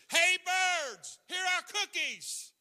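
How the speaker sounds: loud call. A narrator's reading. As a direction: call the birds from across the yard. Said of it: "He shouted in a loud voice".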